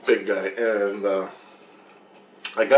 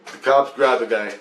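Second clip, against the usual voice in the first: ordinary delivery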